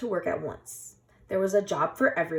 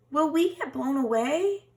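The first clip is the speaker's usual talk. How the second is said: scared bunnies; 9 semitones higher, 5 dB louder than usual. A narrator's reading. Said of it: "they cried".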